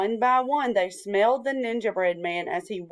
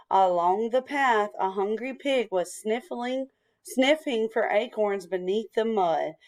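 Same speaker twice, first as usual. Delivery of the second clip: ordinary delivery, nothing marked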